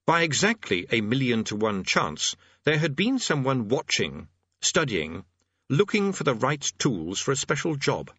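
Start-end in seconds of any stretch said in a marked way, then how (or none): none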